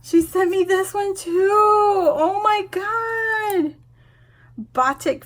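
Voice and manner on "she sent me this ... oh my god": high wail, slowing right down; tearful, stretched-out joy; overwhelmed delight. Real, not acted. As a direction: wail it as if near happy tears. Overwhelmed by second fan.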